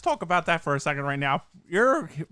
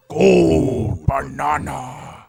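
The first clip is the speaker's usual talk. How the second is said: slow, drawn-out, louder announcer voice; Donkey Kong announcer; 2 semitones lower, 7 dB louder, much slower than usual. Retold or imitated. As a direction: stretch it out like a game announcer. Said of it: "the way they do in Donkey Kong sixty-four is like".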